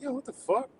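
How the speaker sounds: high, softened incredulous reaction; high-pitched bafflement. Retold or imitated. Streamer recalling fake accounts in chat, high-pitched bafflement. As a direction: squeak out your disbelief lightly.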